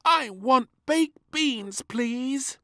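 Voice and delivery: high, slow whiny complaint; moaning little princess. Acted. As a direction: whine at the lunch table. Princess demanding beans.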